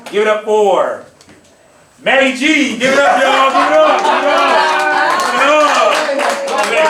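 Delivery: loud, high host shout to the crowd; hype shout. Real, not acted. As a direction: pump up the audience, yelling.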